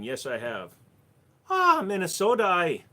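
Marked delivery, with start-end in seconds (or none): 1.5-2.8 put-on Minnesota accent. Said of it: "not to do the Minnesota one".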